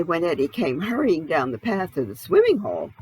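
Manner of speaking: usual speaking voice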